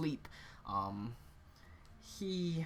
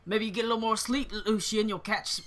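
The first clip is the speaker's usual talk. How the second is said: fast, loud, higher-pitched jeer; cocky, raised-voice teasing; mocking taunt; 7 semitones higher, 6 dB louder than usual. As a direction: toss a taunt back while dodging away. Prankster taunts as he dances away.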